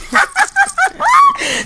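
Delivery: a big laugh, high and loud; high delighted laugh. Real, not acted. Host laughing after teasing her partner, loud laugh.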